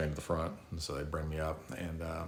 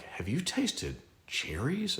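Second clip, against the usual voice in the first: much higher, slow and awed question; wonder-struck; 9 semitones higher, much slower than usual. Retold or imitated. Survivor to friends about cherries, slow and awestruck. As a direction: marvel like it's a revelation.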